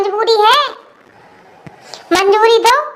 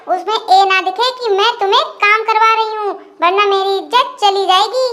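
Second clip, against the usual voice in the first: normal talking voice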